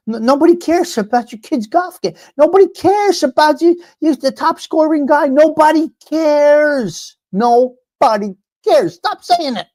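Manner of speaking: high-pitched, stretched-out exasperation; exasperated rant. Real, not acted. Ranting at parents bragging about their kids, exasperated. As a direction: drag out the words in high exasperation.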